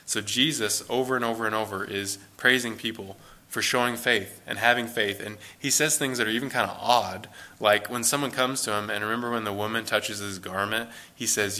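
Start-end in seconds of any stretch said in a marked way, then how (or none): none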